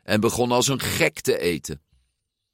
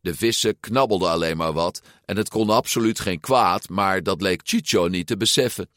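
plain reading voice

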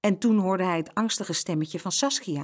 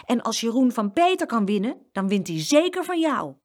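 put-on higher girl's warning; pointed clarification; 5 semitones higher, 4 dB louder than usual. A narrator's reading. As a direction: spell it out for the boaster. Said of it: "zei ze er voor alle duidelijkheid bij".

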